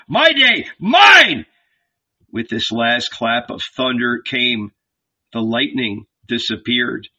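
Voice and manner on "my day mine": shouted high and loud, claiming the day; God's voice shouting, much higher and louder; furious shout. A narrator's reading. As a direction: roar it like a thunderclap. The voice's final thunderclap.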